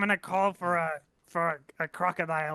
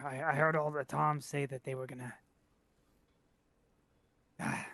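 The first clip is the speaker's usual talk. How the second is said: low, halting delivery with long pauses; lightly acted last words, trailing and subdued; dying messenger; 3 semitones lower than usual. Player's character breaking bad news while dying, low halting voice.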